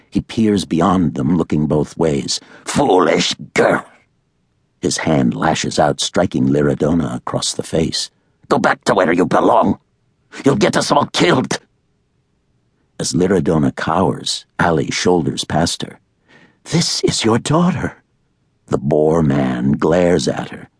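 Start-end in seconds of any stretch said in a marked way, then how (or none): 2.6-3.9 angry father. A narrator's reading. Boar man scolds daughter. 8.5-11.6 angry father. A narrator's reading. Boar man yelling at daughter. 16.6-18.0 put-on frightened young woman asking for help; Allie pleading. A narrator's reading. Allie confronts father.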